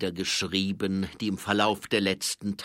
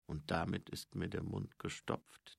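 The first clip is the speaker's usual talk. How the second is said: soft inner voice; in Gedanken; 8 dB quieter than usual. A narrator's reading. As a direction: think it to yourself, much quieter. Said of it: "dachte Mumm". (leise, wie zu sich selbst gedacht)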